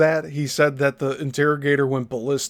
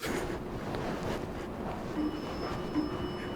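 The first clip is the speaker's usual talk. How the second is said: breathy laugh; 12 semitones higher, 15 dB quieter than usual. Real, not acted. Laughs at the story.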